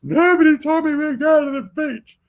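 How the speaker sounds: put-on high, loud panicked child wail; shrill-free but very high, loud protest of a startled boy; son freaking out. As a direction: squeal it like a kid caught off guard. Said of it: "he freaks out".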